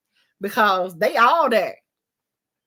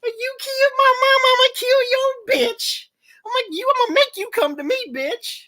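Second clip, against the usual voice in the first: much higher, hyped-up threat; vengeful taunt; 10 semitones higher, 5 dB louder than usual. Retold or imitated. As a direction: crow over the revenge, fast and high. Voicing vengeful character.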